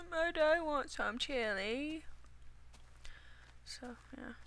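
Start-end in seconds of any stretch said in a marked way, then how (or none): 0.1-2.1 slow, higher dog's inner voice. Pretend. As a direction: speak the dog's thoughts, slow and pleading. Voicing the dog's look.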